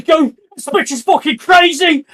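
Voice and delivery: very high, loud panicked yell; panicked shout. Acted. Fleeing thug yells to his friend, very high and loud. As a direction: shout it while bolting away. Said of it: "the other guy's like".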